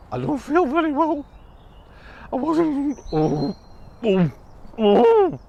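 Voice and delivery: high, woozy and sick; queasy mouse voice. Acted. Airsick mouse moans after landing. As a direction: say it dizzy and weak.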